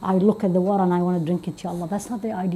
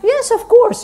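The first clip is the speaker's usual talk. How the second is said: mock eager answer; 6 dB louder, slower than usual. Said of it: "What's the answer?".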